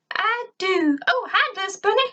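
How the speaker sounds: very high, giddy little robot voice; squeaky robot sidekick. Acted. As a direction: chirp it like a dizzy helper bot. Grr voiced, distracted.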